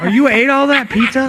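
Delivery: high, loud teasing question; mock accusation. Teasing a co-host about the pizza, mock accusation. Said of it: "I'm just kidding".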